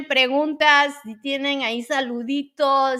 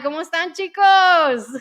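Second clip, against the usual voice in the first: high and loud, calling out to chat; excited greeting; 6 semitones higher, 8 dB louder than usual. Real, not acted. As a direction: greet everyone brightly. Cheerful greeting to viewers.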